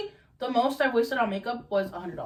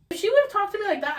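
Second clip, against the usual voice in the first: 1 outraged; 11 semitones higher, 5 dB louder, much faster than usual. Real, not acted. Outraged hypothetical.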